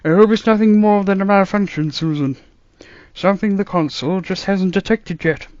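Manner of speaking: put-on musing old man, baffled; puzzled grandfather voice; old doctor voice. A narrator's reading. Doctor hopes.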